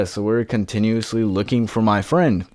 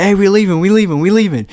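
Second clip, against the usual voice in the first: high, louder panicked pleading; 11 semitones higher, 5 dB louder, faster than usual. Retold or imitated. Teen fleeing the woman with a shotgun, panicked high yell. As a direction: back away fast, begging her not to shoot. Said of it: "I'm like".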